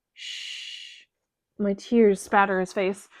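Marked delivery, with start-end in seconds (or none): 0.1-1.0 soft hush. Acted. Dying man hushes her.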